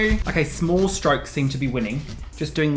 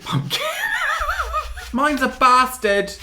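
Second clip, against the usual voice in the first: laughing; 11 semitones higher, slower than usual. Real, not acted. Laughing at stubborn pumpkin.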